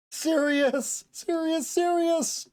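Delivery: high, drawn-out cry of a name; loud calling out. Acted. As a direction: call someone back from far away. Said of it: "shout over and over with that stupidly loud voice".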